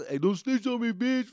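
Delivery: put-on high, teasing kid voice; mock your busted friend, giggly; giggling homies. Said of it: "they're all laughing and giggling like this".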